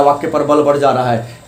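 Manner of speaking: usual speaking voice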